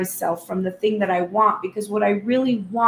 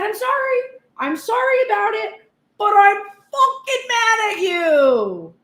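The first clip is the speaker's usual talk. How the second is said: much higher, sharp and a little louder, snapped; furious apology; 12 semitones higher, 5 dB louder than usual. Retold or imitated. Venting at the partner, a furious high-pitched apology. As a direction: fling the apology out in a fury.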